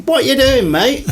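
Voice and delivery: high, cheeky shouted call over a wall; mock shout. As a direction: call out like a cheeky fifteen-year-old. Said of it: "So I just shouted".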